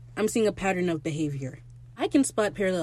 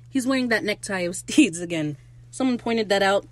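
ordinary talk

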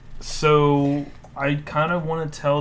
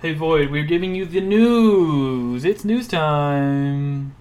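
announcer voice; 5 dB louder, much slower than usual. Pretend. Announcing news segment.

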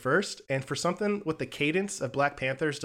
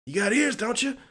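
high-pitched impression of a bandleader; 11 semitones higher, 4 dB louder than usual. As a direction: challenge the musician in a raised, put-on voice. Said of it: "[name]'s telling him".

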